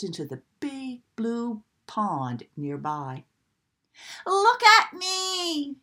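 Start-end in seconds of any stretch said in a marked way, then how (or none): 4.3-5.8 high, loud, slow call; happy shout. A narrator's reading. Duckling swimming circles calling out, happy loud shout. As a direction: shout with delight while swimming. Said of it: "he shouted".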